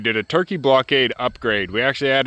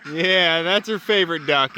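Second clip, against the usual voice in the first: ordinary delivery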